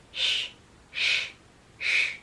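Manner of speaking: long sh like hushing someone; shushing sh. Shown as a demonstration. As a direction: shush like telling someone quiet. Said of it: "telling you to quiet down".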